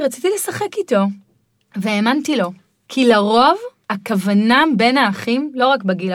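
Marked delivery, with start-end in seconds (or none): 0.0-1.0 put-on a toddler explains himself sweetly; child's voice. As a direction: answer innocently like a small child. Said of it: "אז הוא אמר לי".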